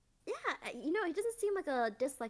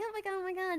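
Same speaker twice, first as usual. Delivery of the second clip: higher-pitched imitated panic; light, flustered retold alarm; mild mock freak-out; 6 semitones higher, much faster than usual. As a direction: play at freaking out a little. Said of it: "a little freaking out".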